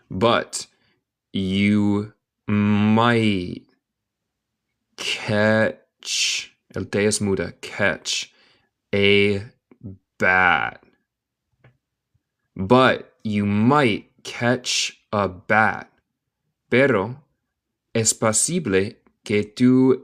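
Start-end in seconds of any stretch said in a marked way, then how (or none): 0.1-10.8 very slow, long pauses between words; word-by-word dictation. Shown as a demonstration. Teacher breaking down a sentence for learners, word by word. 12.6-15.9 slow, measured full sentence; slow connected reading. Shown as a demonstration. Teacher repeating the sentence for learners, slow and connected.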